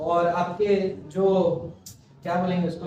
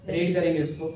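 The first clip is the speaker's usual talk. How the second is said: ordinary delivery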